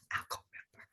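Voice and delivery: very soft mimicked whisper; hushed aside. Younger daughter offering to leave too, hushed aside. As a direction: imitate someone quietly slipping away.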